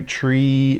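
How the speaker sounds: "tree" with a long held "ee"; stretched-out E. Shown as a demonstration. Teacher helping children hear the ending, "tree" with stretched-out E. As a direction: hold the final E sound of "tree". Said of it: "we can stretch out that E sound".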